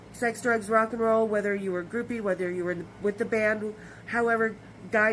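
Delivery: normal talking voice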